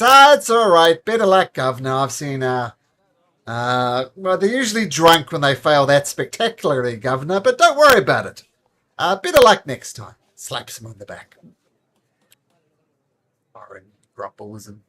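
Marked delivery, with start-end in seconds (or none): 0.0-10.1 Horatio Tane. Acted. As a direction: clap him on the back with a big, jolly consolation. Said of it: "And Horatio Tane says".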